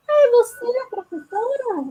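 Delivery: high, doubtful "you're a teacher?"; imitating a condescending question; surprised disbelief. Imitating people doubting her job.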